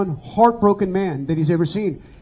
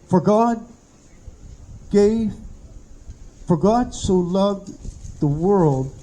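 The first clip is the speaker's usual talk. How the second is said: slow broken reading with long pauses between words; a dying man barely getting the verse out; halting reading; slower than usual. Retold or imitated. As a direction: read it word by word, struggling through. Dying man reads scripture haltingly.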